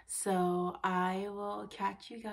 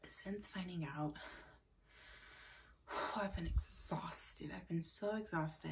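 tired whisper; 2 semitones lower, 7 dB quieter than usual. Real, not acted. Tired pregnant vlogger whispering.

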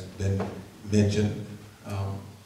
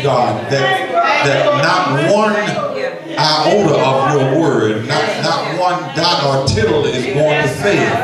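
louder, rising, shouted praise; fervent preaching prayer; 7 semitones higher, 11 dB louder than usual. Acted. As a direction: build the prayer into a full church shout.

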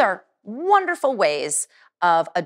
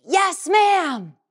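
shouted yes ma'am; 9 semitones higher than usual. Shown as a demonstration. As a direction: shout it back like a cadet.

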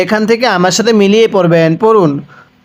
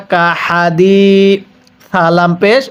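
হাদি আর হাদিসুল ধীরে টেনে টেনে পড়া; slow spelled reading; much slower than usual. Shown as a demonstration. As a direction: read each syllable slowly and drawn out. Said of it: "তাহলে". (অক্ষর ধরে ধরে ধীরে পড়া)

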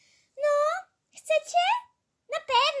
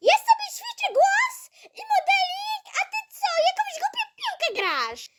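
higher, laughing, showing off to her sister; haughty diva; 9 semitones higher than usual. Acted. Sister brags about her voice practice, laughing and haughty. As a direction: preen like a little diva.

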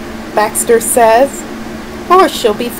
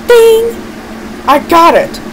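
excited idea; 7 semitones higher than usual. Acted. Villain gets an idea.

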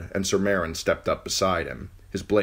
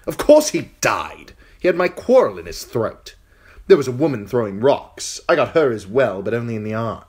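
put-on high, loud, bragging young king; boasting boy king; 6 semitones higher, 8 dB louder than usual. King boasting to the captive girl, high and loud. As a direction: brag about the killing, proud and cruel.

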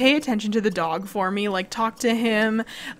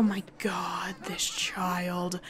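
exasperated whispered oh my God; 4 semitones lower, 9 dB quieter, much slower than usual. Real, not acted. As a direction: breathe it out in disbelief. Exasperated at the child.

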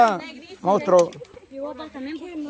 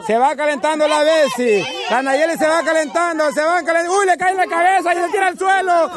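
higher-pitched live game commentary; building game-host excitement; excited play-by-play; 6 semitones higher than usual. Real, not acted. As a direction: call the action as the players close in. Play-by-play as players near target.

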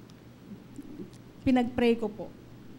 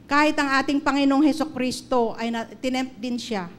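preaching. Acted. Teaching point preached to congregation.

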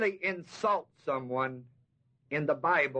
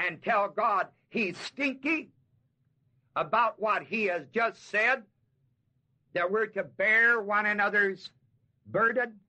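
building preaching; 5 semitones higher than usual. Acted. Preacher urges the church to share burdens, rising. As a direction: drive the appeal home.

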